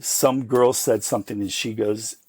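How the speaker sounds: usual speaking voice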